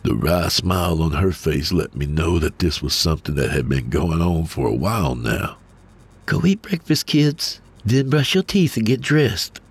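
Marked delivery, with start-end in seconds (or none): 6.3-9.6 higher voiced mom giving orders; instructing mother. A narrator's reading. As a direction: send the kids off briskly. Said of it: "she instructed".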